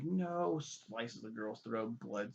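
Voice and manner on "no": high anguished cry of no; Catelyn's cry. Retold or imitated. Retelling Catelyn seeing her son die, high cry. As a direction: fling out a sharp high no. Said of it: "She's like".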